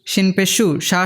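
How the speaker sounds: usual speaking voice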